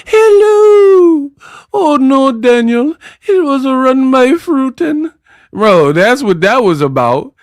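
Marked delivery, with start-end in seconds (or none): 0.1-5.1 Mrs. Doubtfire impression. Mocking a boxer as a nanny, high falsetto granny voice. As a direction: squeak it out like a frantic old nanny. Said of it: "She fought Miss Doubtfire".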